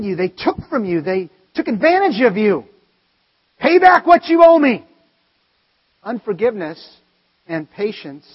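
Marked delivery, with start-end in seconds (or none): 3.6-4.8 demanding voice. Acted. Voicing a grudging demand.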